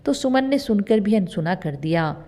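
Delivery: plain reading voice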